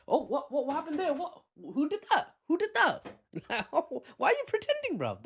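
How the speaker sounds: high, sarcastic 'who could have done that'; mock innocent surprise. Emirati voice mocking Israel's denial, with high sarcastic surprise. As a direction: feign shock, mocking the denial. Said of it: "That's what the Emiratis are hinting at".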